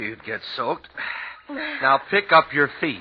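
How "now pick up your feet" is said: slow, loud and high call; calling over wind. Acted. Husband urges his wife through the snow drifts, loud and slow. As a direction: push each word out over the storm.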